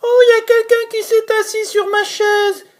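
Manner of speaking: very high, fast squeaky little bear; tiny bear's voice. Acted. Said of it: "de sa toute petite voix".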